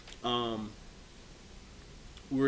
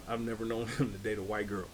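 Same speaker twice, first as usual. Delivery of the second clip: ordinary delivery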